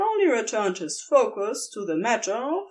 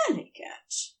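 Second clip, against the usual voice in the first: soft whisper; 7 dB quieter than usual. A narrator's reading. Soft praise between kisses.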